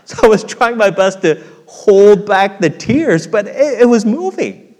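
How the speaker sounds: loud, high, half-laughing sermon telling; mock tough preaching. Preacher confessing movie tears to congregation, loud and high. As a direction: play up the tough guy fighting tears. Preaching story, full force.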